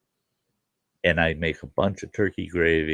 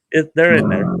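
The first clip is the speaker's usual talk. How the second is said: much higher, louder matter-of-fact wife; mock wife; 9 semitones higher, 6 dB louder than usual. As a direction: answer like a wife unbothered by the question. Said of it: "She goes".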